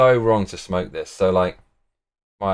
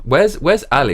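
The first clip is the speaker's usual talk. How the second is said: ordinary talk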